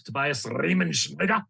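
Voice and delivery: Tobias Riemenschneider said with a careful German pronunciation; careful German name. Shown as a demonstration. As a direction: give Riemenschneider its proper German sounds. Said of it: "Just gotta say it the right way".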